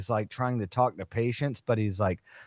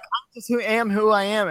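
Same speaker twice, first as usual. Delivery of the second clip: high mocking girl voice; 11 semitones higher, 4 dB louder than usual. Mocking a picky dating-show girl in a high voice. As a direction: parrot her in a high voice. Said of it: "And she's like".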